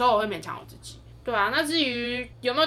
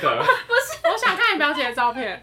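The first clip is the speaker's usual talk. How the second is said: laughing; 6 semitones higher, 5 dB louder than usual. Real, not acted. Laughing reply.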